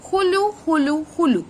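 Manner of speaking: high-pitched parrot hello call; talking parrot. A narrator's reading. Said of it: "exactly like a human ... human voice".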